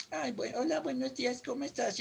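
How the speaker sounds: soft, unprojected greeting; too quiet. Acted. Imitating a timid-voiced speaker greeting people, too quiet. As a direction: say hello softly, voice held in. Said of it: "Dilo más fuerte".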